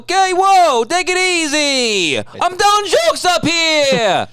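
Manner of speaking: high, drawn-out loud Italian comic; hammed-up Italian, stretching the line ends; Sebastian Maniscalco. Host mimics an Italian comedian on stage, brash Italian. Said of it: "they sound like what I just did".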